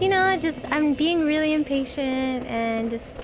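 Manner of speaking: ordinary delivery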